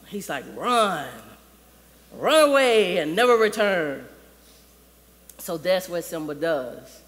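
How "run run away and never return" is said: loud, commanding villain order; Scar. Retold or imitated. Scar orders Simba to run, loud and commanding. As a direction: send him away forcefully, loud. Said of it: "Scar rolls up on him".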